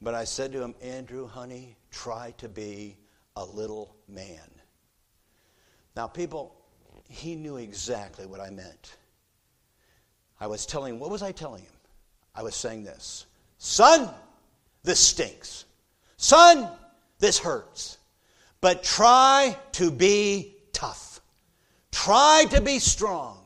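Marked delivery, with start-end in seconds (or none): 0.8-4.6 put-on soft, low, slow words into a child's ear; gently hushed, drawing out the advice; retold whisper. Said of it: "I whispered in his ear". 13.6-23.4 loud, high punches with pauses between; forceful urging. Retold or imitated. Father urging hurt son, preached loudly.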